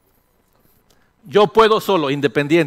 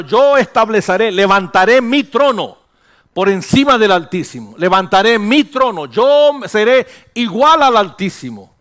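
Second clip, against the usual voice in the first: louder, higher proud boast of the devil; hammed-up pride, drawn out at the end; devil's boast; 6 semitones higher, 7 dB louder than usual. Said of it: "¿Cómo dijo el diablo?". (el diablo presumiendo, más fuerte y agudo)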